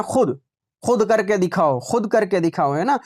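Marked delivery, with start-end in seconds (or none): 0.0-0.4 खुद with a short u, "khud"; as it is read. Shown as a demonstration. Teacher gives the real reading, खुद with silent waw. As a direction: keep the vowel in खुद short. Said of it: "लेकिन पढ़ते क्या है?".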